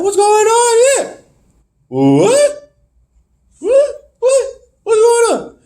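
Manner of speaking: high, squeaky string of questions; mock bewilderment. Viewer reacting to a surprise run-in, mock bewilderment. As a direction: squeal in confused disbelief.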